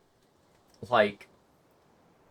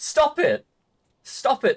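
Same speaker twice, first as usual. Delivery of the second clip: high-pitched exasperated protest; mock outrage; 10 semitones higher, slower than usual. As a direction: protest in playful disbelief. Mock outrage at South African accents.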